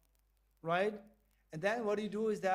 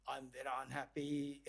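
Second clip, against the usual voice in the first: lower, softer moaning complaint; mock complaint; 7 semitones lower, 7 dB quieter than usual. Shown as a demonstration. Teacher mocking a complaining mind, low and soft.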